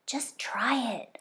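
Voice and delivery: slow hushed whisper. A narrator's reading. Sam urging friends to try the food, slow whisper. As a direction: coax softly under your breath. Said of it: "I whispered back".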